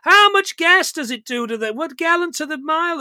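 put-on high, loud nosy kid; annoying kid voice. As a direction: pester like a bratty neighbour kid. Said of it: "He has got the voice of a fifty-year-old man".

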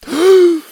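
loud high gasp; sharp gasp. A narrator's reading. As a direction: gasp as you're pulled under. Sucked down.

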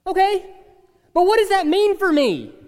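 lightly voiced complaining churchgoer; objector. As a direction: push back like a skeptic. Imagined objecting listener.

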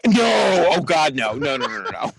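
real, horrified shout of refusal; emphatic denial. Real, not acted. Friend rejects the idea he has money, loud emphatic denial. Appalled answer to money question.

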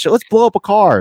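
hammed-up Irish lilt; mock Irish accent. Shown as a demonstration. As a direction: show off a rough Irish accent. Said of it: "It's somewhat Irish".

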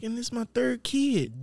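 lightly voiced girlfriend, high and slow; hint of a high girl voice. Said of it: "She was like".

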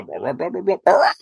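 high squawking blah blahs; bird squawk. Acted. Alien doctor bursting with joy, squawking like a bird. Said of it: "and he squawked".

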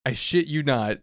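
ordinary talk

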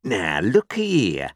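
slow, drawn-out call to attention; warning convict. A narrator's reading. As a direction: lay down the law to a child. Convict warns the boy.

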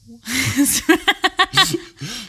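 long loud giggle; giggling fit. Real, not acted. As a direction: burst out laughing at yourself. Host giggles after missing season.

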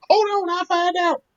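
excited wife. Retold or imitated. Wife voiced again, excited.

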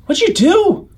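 put-on high indignant boy voice. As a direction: ask it high and accusing, like a kid. GM voices Cecil accusing.

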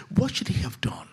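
ordinary talk, nothing marked

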